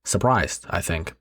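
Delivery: ordinary delivery, nothing marked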